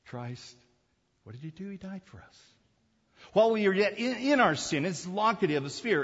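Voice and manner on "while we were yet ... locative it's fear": raised, ringing pulpit voice; preaching at full force. Acted. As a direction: drive the point home to the congregation.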